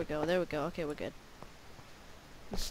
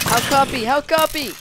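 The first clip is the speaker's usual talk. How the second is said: higher, louder urgent call; urgent radio call; 7 semitones higher, 5 dB louder than usual. Pretend. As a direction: push it with urgency. Radio callout repeated louder.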